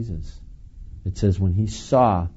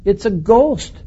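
terrified cry; 11 semitones higher, 6 dB louder, slower than usual. Acted. Disciples' terrified cry quoted.

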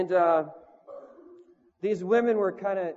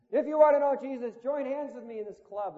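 raised preacher's call, pitch up; calling out to the club crowd; 6 semitones higher, 4 dB louder than usual. Retold or imitated. Preacher calling out to the club crowd, raised voice. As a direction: call the crowd to Jesus over the noise.

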